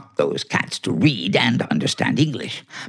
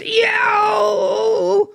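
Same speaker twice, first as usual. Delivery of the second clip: pained cat yowl. Acted. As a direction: yowl like a cat with a stepped-on tail. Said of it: "I'm hurt".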